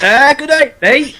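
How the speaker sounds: high, exaggerated Aussie twang; sudden Australian accent. Australian just off the boat, suddenly broad mock accent. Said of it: "develop the accent as soon as they step off".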